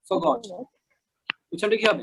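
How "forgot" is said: higher "Forgot" with no r sound; silent R. Shown as a demonstration. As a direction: model "Forgot" as faw-got. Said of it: "এখানে আর উচ্চারণটা কিন্তু হবে না".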